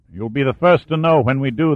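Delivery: ordinary delivery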